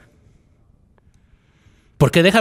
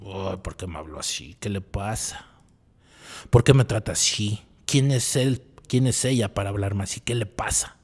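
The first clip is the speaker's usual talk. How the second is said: muttered under the breath, much softer, resentful; inner angry rant; 7 semitones lower, 8 dB quieter than usual. Retold or imitated. Speaker voicing his own silent anger at someone rude, muttered low. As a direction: grumble it to yourself like a stewing neurotic. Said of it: "empiezo a sentir la ira interna".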